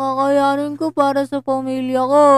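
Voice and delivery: put-on high, slow melodramatic child; tearful child. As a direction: plead like a weary radio drama kid. Voicing the child, radio drama.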